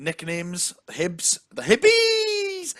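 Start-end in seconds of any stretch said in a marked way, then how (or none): 1.5-2.7 very high, drawn-out mock cheer; comic falsetto cry; silly squeal. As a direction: squeal it and stretch the ending. Silly squealed team nickname.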